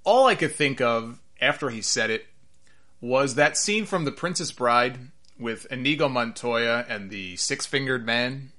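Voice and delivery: ordinary talk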